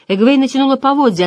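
ordinary delivery, nothing marked

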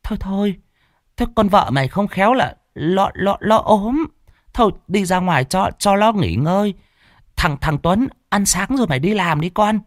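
put-on high, placating old woman's voice; smooth things over for the family; old mother soothing. A narrator's reading. (giọng bà mẹ cao, dàn xếp)

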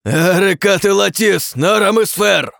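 high, loud, chanted invocation; spell incantation. A narrator's reading. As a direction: bellow the names as a spell.

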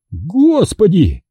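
high, drawn-out exclamation; wailing cry. A narrator's reading. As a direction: wail it in dismay, stretched out. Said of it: "возопил".

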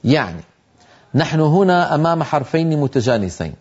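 usual speaking voice

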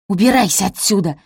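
forceful, loud hissed order; angry hiss. A narrator's reading. Araminta ordering her daughter out, hissing angrily. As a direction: spit out the command. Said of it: "прошипела Араминта".

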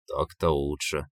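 lightly voiced low, quiet villain; Koschei, satisfied. Koschei gets his head back, quietly satisfied. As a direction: say it low and soft, as Koschei settling down.

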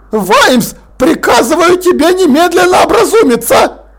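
pompous lord barking an order; growling Lord Rust. Acted. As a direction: snap the order like an outraged old aristocrat. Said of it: "зарычал лорд Ржав".

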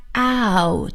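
ow drawn out as one gliding vowel; OW vowel demo. Shown as a demonstration. Teacher showing the vowel in town, ow held long. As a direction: sound out a long, clear ow. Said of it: "应该是发ow的音".

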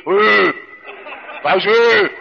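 mock voice with a lump in the throat; choked adolescent croak. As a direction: grunt it as if something is stuck in your throat. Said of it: "صوته بقى كده زي ما يكون ورك ديك رومي واقف في زوره". (صوت مخنوق كأن شيئًا واقف في الزور)

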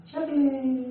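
high, long "-eh" on "شغلة", Levantine style; exaggerated Levantine sing-song "shaghleh"; Syrian-Lebanese accent. Shown as a demonstration. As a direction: pitch "شغلة" up and hold the ending like a Syrian. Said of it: "هكذا".

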